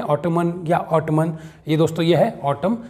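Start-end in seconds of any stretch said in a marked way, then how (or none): 0.0-1.4 two slow mistaken takes of ऑटमन, both ending in N; odd misreadings. Shown as a demonstration. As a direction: slowly say ऑटमन with a sounded final N. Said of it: "अजीब तरह से पढ़ते हैं". (ऑटमन को धीरे, अंत में न के साथ बोलें)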